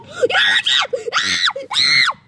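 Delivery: high screaming fury, then scream; berserk rage. Acted. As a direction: scream with all you've got. Rage climax, final scream.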